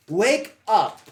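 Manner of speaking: high, booming wake-up yell; loud shout. Acted. Player yells at an unmoving tiefling, loud shout. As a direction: shout it to rouse someone out cold. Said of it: "and just loudly say".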